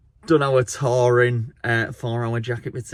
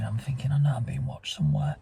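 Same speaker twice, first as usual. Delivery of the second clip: whispering; 3 semitones higher, 7 dB quieter than usual. Retold or imitated. Spooky retold feeling of being watched.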